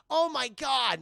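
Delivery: high, loud mock amazement; hammed-up shock; astonished discovery. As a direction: gasp it out as a stunned realization. Character's reaction to Batman secret.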